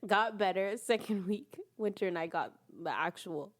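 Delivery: ordinary talk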